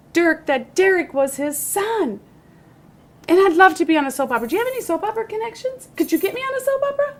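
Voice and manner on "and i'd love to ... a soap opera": excited. Acted. Begging for a soap role.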